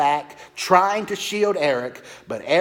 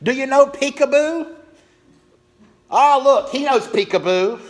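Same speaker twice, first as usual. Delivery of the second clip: put-on much higher, louder drunken shouting; shouting across room; 11 semitones higher, 6 dB louder than usual. Drunk old man shouting games at baby across the room, loud. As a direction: call out across the restaurant to a baby. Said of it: "began shouting from across the room".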